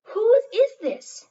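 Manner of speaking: loud, high, slow demand to the class; teacher demanding. Acted. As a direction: boom it out like a stern teacher calling the room. Teacher demands owner of sweater.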